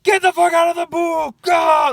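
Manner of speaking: put-on guard imagined roaring at kids in the pool, furious shout; imagined furious yell. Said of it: "I thought it was gonna be like".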